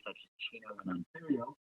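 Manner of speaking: faint, distant voice on the call; speaking too far from the phone; phone held away. Real, not acted. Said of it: "Could you speak closer to the phone".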